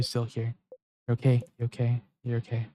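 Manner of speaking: fading reassurance. Acted. Reassuring herself in the stall, fading softly. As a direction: soothe yourself, letting it die away.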